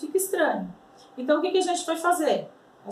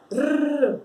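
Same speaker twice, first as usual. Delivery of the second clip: rolled trilled rrr. Shown as a demonstration. Teacher demonstrating the Spanish R, strongly rolled. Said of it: "forçar esse R aí".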